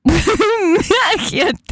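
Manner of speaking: ordinary delivery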